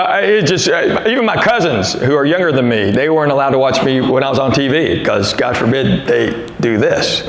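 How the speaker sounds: ordinary delivery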